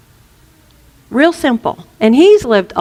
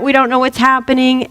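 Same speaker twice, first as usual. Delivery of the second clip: ordinary talk, nothing marked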